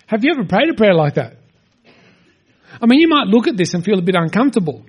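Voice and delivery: ordinary talk, nothing marked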